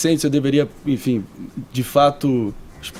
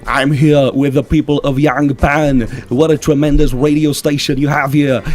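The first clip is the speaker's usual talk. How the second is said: Arnold Schwarzenegger. Acted. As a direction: mimic the Terminator turned governor. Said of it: "o exterminador Arnold Schwarzenegger". (imitação de Schwarzenegger, pausada e com sotaque austríaco)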